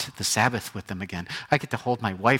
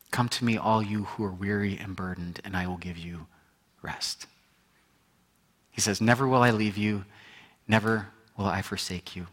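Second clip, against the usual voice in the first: soft, low, tender promise; Jesus comforting the weary, soft and tender; 5 semitones lower, 5 dB quieter than usual. Retold or imitated. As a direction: speak gently, like a comforting voice.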